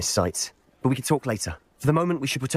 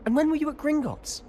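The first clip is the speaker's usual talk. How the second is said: surprised. Acted. Sebastian asking in shocked surprise about Gringotts. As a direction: pitch jumps high in disbelief.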